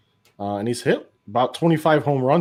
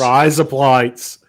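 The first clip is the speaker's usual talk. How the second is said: slow, louder Aussie phrase; playful dad-joke Australian; mock Australian accent; 6 dB louder, much slower than usual. Shown as a demonstration. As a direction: lean into the accent for fun. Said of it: "Australian accents".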